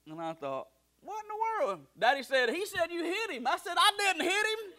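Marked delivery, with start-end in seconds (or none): 1.0-1.9 very high-pitched disbelief; hammed-up baffled squeal of a thought; mock astonishment. Son reacting to dad's news, mock high-pitched astonishment. 2.5-3.5 high-pitched mock accusation; accusing dad. Dad passing on preacher's complaint, high mock accusation. 3.8-4.7 very high-pitched retold protest; mock indignant denial. Son denying it to dad, high mock indignation.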